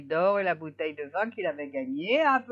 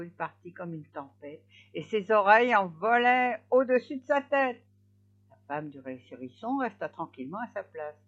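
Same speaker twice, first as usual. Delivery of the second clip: ordinary delivery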